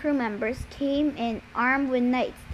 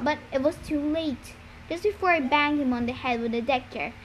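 ordinary delivery

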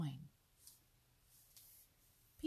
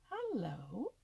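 higher, surprised greeting; exclamation; 4 semitones higher than usual. A narrator's reading. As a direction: greet the unexpected visitors in surprise. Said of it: "exclaimed Old Mother Nature".

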